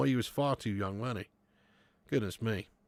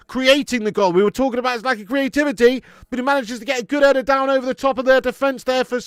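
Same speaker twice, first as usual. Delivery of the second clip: excited recap; 8 semitones higher, 11 dB louder than usual. Real, not acted. Excited recap after the goal.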